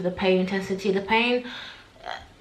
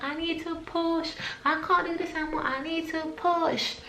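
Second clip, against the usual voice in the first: pleading; 9 semitones higher, 6 dB quieter than usual. Retold or imitated. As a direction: beg like you can't take more. Labour pleading retold.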